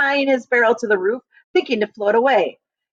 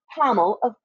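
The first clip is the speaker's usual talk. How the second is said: ordinary delivery, nothing marked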